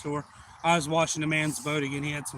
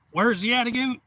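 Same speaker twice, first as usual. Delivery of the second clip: loud, raised-pitch query; acted urgent question; 7 semitones higher, 5 dB louder than usual. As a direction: imitate someone calling out to locate it. Owner asks again before shooting.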